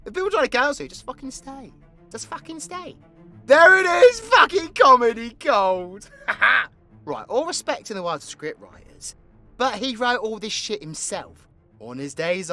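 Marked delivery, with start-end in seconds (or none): 3.5-6.1 loud, high crowing yell; big exultant shout, easing off at the end; triumphant shout. Acted. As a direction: holler it like you struck gold. Crowing over a joke.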